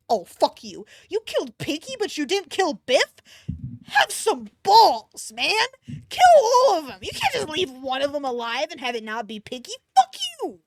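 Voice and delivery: high, loud furious yelling; outraged rant. Real, not acted. Reader ranting at the author over Biff. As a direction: rage at the writer.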